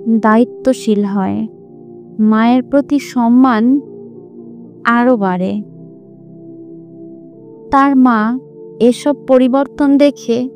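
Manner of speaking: usual speaking voice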